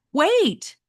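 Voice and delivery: calling out. Acted. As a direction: call after them to stop. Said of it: "Metalhead called".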